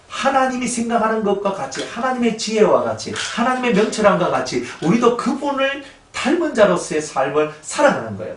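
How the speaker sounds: 목소리를 높여 힘주어 외치는 설교; 청중을 다그치듯 열띠게 설교하기. Acted. Preaching at full force. (회중에게 열정적으로 설교하는 목사처럼 힘차게)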